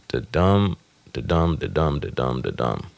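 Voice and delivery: da-DUMB, hitting dumb; iambic beat. Shown as a demonstration. Explaining a foot, chanting the DUMB beat. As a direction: stress dumb each time. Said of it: "five feet of iambs".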